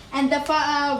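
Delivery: usual speaking voice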